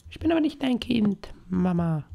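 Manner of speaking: put-on tiny, very high squeaky child voice. Child telling mama it is not hers, squeaky child voice. Plays a child answering the mother.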